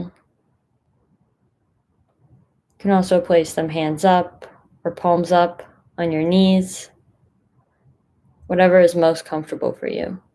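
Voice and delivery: unhurried guidance with long pauses between phrases; guided meditation. Acted. Meditation leader inviting listeners to find a comfortable position, guided meditation. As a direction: settle listeners in, leaving space after each instruction.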